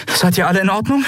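hohe Frauenstimme, besorgt; Endriel fragt. Acted. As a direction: kurz nach Atem, besorgt. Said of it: "fragte Endriel". (Endriel fragt ihre Crew besorgt)

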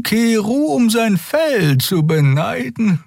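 a cold reptilian creature voicing her dislike of winter; alien Xea voice. Xea complaining to the crew about the cold, high and slow.

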